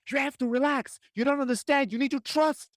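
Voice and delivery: high, strained insistent urging; tense pushy urging. Retold or imitated. Someone tensely ordering a friend to relax, high strained pleading. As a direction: demand relaxation with total tension. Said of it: "but we're like".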